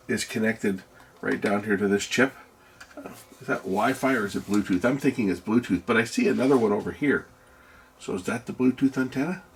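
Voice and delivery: normal talking voice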